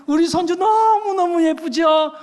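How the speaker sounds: high, drawn-out doting coo; gushing grandmother. Retold or imitated. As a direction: imitate a proud grandma, stretching the praise. Gushing grandmother asks the pastor.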